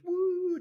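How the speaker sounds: loud, high-pitched drawn-out woo; high whoop. Retold or imitated. Imitating the bouncing on screen.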